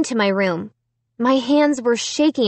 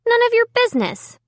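snippy brush-off; 14 semitones higher, faster than usual. A narrator's reading. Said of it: "I told him".

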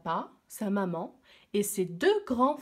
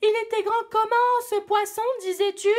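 much higher, louder teasing question; mocking; 12 semitones higher, 7 dB louder, faster than usual. Villagers mocking the older brother, loud and teasing. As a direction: taunt him with a sing-song jeer. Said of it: "d'un air moqueur".

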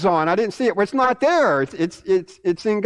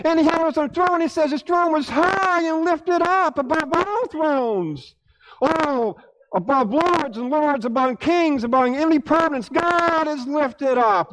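high, swelling sermon voice; impassioned preaching; 7 semitones higher than usual. Acted. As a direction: exalt with a high, ringing preacher's cry.

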